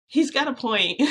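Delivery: excited exclaim. Real, not acted. Caught out, laughing at herself.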